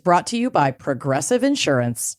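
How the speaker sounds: upbeat sponsor read. Acted. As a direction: read it like a radio ad. Reads a sponsor ad.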